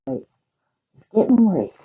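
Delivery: ordinary delivery, nothing marked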